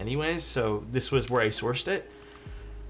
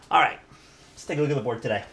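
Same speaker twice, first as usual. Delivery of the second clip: usual speaking voice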